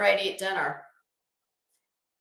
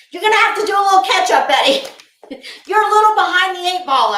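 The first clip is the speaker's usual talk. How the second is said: loud teasing; 7 semitones higher, 10 dB louder, faster than usual. Acted. Teasing a live-stream personality.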